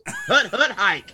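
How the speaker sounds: quarterback calling cadence. Pretend. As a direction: call the snap like a quarterback. Mock football snap call.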